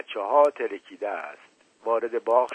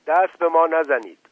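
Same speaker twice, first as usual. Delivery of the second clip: raised cry, higher and louder; a lightly acted wounded shout; 5 semitones higher, 5 dB louder than usual. A narrator's reading. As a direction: cry out in pain not to be touched. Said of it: "فریاد مشقاسم بلند شد".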